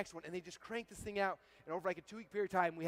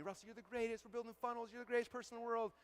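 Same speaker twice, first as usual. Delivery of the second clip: imitating gushing fans, higher-pitched; adoring fans; 6 semitones higher, 3 dB quieter than usual. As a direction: gush in a high mock-fan voice. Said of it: "they all love me".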